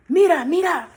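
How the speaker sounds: high, loud bird call; urgent birds. Acted. Birds urging Roja to look, high loud cry. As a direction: cry out to make her look.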